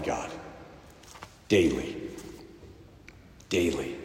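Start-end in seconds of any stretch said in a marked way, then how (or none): 1.5-4.0 softer and lower, dropping to near quiet; a quiet, emphatic repetition; hushed repeat. Real, not acted. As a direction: let the word sink in quietly. Preacher repeats key word.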